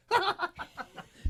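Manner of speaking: very high, loud burst of laughter; imitated laugh. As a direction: mimic a buddy cracking up.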